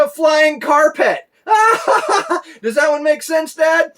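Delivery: very high, loud shrieking punchline with laughter; gleeful toddler punchline. Retold or imitated. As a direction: shriek it out delighted like a little boy. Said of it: "He said".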